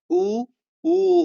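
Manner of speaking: high, loud उ with rounded lips; rounded oo demo. Shown as a demonstration. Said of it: "होठ गोल हो जाते हैं". (होठ गोल करके ऊँचा उ)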